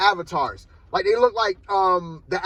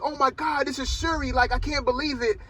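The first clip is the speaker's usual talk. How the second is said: higher-pitched mock fan excitement; starstruck excitement; 6 semitones higher, 4 dB quieter than usual. Retelling her first meeting with Shuri, mildly starstruck. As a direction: lightly imitate an excited fan. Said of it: "She's so excited".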